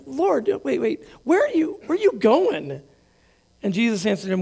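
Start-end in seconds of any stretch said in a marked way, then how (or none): 0.0-2.8 high, urgent questioning; anxious disciple calling out; alarmed Peter. Retold or imitated. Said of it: "And Simon Peter says, like".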